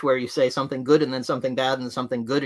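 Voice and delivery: ordinary talk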